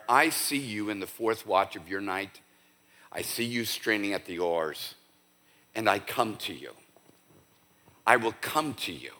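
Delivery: low, soft voice of Jesus consoling; hushed, tender promise; quiet reassurance. Acted. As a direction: speak softly and low, reassuring someone worn out. Voicing Jesus reassuring the weary.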